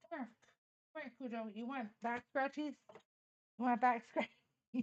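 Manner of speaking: soft, hushed coaxing to a dog; quiet doting pet voice; coaxing pet talk. Real, not acted. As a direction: softly invite the dog over for scratches.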